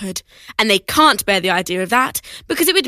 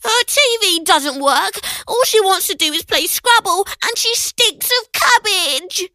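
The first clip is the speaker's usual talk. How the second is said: whiny protest; 8 semitones higher than usual. Acted. Ben keeps protesting.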